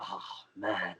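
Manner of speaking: low, soft retold groan; quiet dismay. Wrestler hearing the crowd want another chop, quiet dismay. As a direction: sink low and soft in mock dread.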